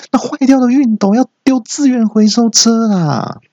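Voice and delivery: high, sustained mock shout; comic scolding yell on one high note; acted yell. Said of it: "我就大叫说".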